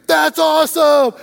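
put-on high, loud, excited boy; thrilled kid shout. Little boy to dad about burgers, thrilled shout. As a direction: burst out like a delighted child.

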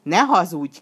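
loud accusing shout; forceful acted yell; demon's shout. A narrator's reading. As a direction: thunder it at a liar. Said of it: "kiáltotta a fekete ördög".

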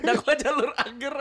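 laughing through the words; giggling while speaking; being tickled. Real, not acted. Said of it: "Aduh, geli banget".

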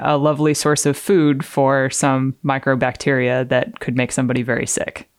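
ordinary delivery

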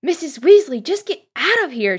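loud, high-pitched acted shout; yelling someone out of danger; urgent shout. A narrator's reading. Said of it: "shouted Harry".

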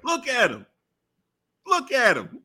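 high, emphatic urging; mock insistence. Co-host defends the wrestler's tough look, mock-earnest insistence.

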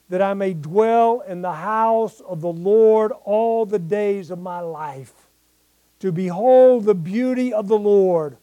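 loud, ringing psalm proclamation; preaching scripture reading. Acted. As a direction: proclaim each verse to the congregation. Reads psalm with preaching force.

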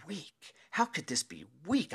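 high-pitched baffled questioning; disbelief. Retold or imitated. Fan brooding over his neighbor's word, in disbelief. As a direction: puzzle over it incredulously.

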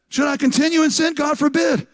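high, insistent question with its own firm rebuttal; emphatic negative. Acted. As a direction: hit "God forbid" high and hard. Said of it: "Most emphatic negative that you can say".

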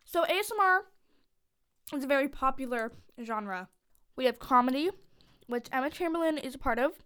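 usual speaking voice

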